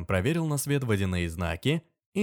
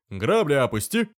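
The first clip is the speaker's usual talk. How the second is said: loud, higher barked command; commanding order; 5 semitones higher, 5 dB louder than usual. Acted. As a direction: bark it like a governor giving orders. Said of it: "приказал воевода".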